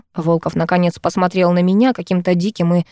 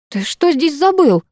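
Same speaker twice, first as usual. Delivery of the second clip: angry; 6 semitones higher, slower than usual. A narrator's reading. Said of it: "рассердилась я".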